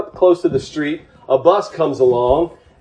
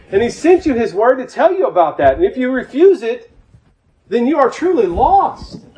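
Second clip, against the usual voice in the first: fervent preaching; 7 semitones higher, 3 dB louder than usual. Acted. Preacher pleading with the congregation, fervent and raised. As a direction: drive it home to the flock. Preacher climax after story.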